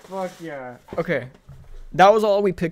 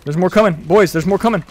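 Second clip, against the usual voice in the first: loud urgent call that more are coming; alarmed warning; 7 dB louder than usual. Real, not acted. Warning companions in firefight.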